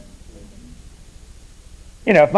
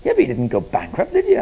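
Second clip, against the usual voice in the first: usual speaking voice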